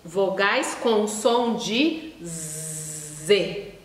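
drawn-out "vogais com som de Z" with a long buzzing Z; teacher slowly buzzing the Z. Shown as a demonstration. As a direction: stretch the Z sound slowly like a teacher. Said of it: "S entre".

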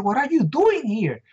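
high, alarmed question; startled question. Retold or imitated. Rescuer finding the guard still at her post, alarmed high question. Running past the security guard.

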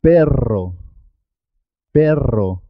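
long trilled rr in "Perro"; marked double R. Shown as a demonstration. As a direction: make the RR of "Perro" vibrate. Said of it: "Es importante marcar la R".

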